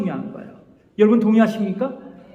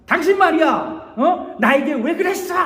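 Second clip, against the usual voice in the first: higher, pointed mock accusation; accusing you-message; 7 semitones higher than usual. Shown as a demonstration. Pastor acts a hurt spouse attacking, mock accusing. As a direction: jab the blame at them. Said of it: "유 메시지를 써서 공격하는".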